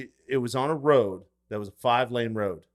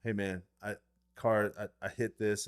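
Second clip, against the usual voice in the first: sheepish confession; 3 semitones lower, 10 dB quieter than usual. Retold or imitated. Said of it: "he would be like".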